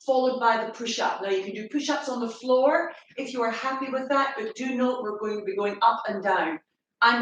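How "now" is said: ordinary delivery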